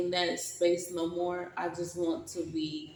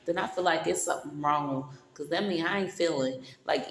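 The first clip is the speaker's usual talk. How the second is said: ordinary talk, nothing marked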